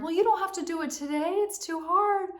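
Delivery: high, quick, enabling coaxing; coaxing rescuer. Retold or imitated. Inner rescuer excusing her, high coaxing voice. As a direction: sweetly talk her out of it. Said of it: "I listen to the rescuer".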